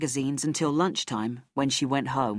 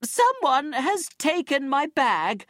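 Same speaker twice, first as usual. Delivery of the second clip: high, slow outraged cry; screamed accusation; 10 semitones higher, slower than usual. A narrator's reading. Robbed woman to the gathering beach crowd, high drawn-out scream. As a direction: cry it out high, drawing out each word. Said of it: "she screamed".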